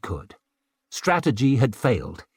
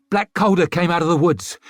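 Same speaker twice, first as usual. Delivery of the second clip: breathing hard; 6 semitones higher, 4 dB louder, faster than usual. A narrator's reading. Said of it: "breathing hard".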